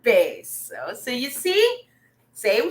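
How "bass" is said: "bass" said like "base", firmly for repeating; deep-voice pronunciation. Shown as a demonstration. Teacher asking class to say it along, "bass" said "base". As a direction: lead the class in "bass", rhyming with face. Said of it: "Say it with me".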